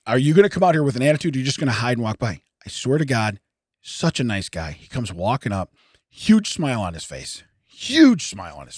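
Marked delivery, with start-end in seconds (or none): 0.1-2.4 quick, rushed inner worry; fast anxious thoughts. Retold or imitated. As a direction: rattle it off nervously.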